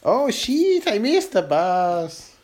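high, stretched out Oh shit; Oh shit 높게 외치고 천천히; dramatic exclamation. Acted. Game of saying phrases in accents.

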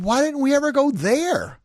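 high, loud exasperated question; stunned, pleading why; incredulous. Retold or imitated. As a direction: demand it in disbelief. Retold incredulous question to mom.

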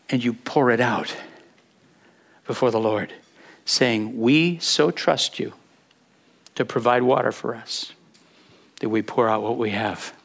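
low, soft and reverent; hushed, trusting prayer. Acted. Preacher voicing thirsty people's prayer to the Lord, hushed trust.

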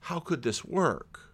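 low, slow, soft musing; acted thoughtful puzzlement, hushed and unhurried; puzzled pondering. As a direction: muse quietly as if to yourself. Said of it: "questions that just make you say".

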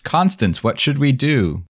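stiff, even line read; robotic. Acted. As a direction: deliver it woodenly, like a robot. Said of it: "say it less robotically".

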